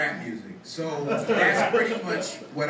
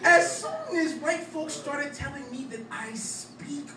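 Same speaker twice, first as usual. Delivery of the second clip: higher, louder, rhythmic slam-poem delivery; spoken-word poetry; 12 semitones higher, 4 dB louder than usual. Acted. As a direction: perform it as a punchy stage poem.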